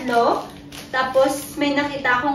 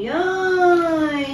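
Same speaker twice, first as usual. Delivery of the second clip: usual speaking voice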